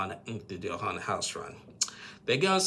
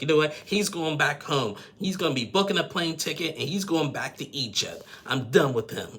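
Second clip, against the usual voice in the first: higher, mildly heated mock rant; acted-out exasperation, voice raised; retold fed-up outburst; 6 semitones higher, 4 dB louder than usual. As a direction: imitate someone declaring they are done. Acting out Debbie declaring it over.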